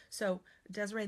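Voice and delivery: ordinary talk, nothing marked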